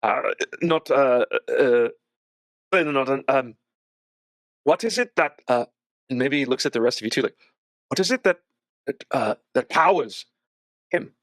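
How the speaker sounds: lightly voiced uneasy young woman; Irina, wary. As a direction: probe the strange guest carefully. Voices Irina questioning Sam.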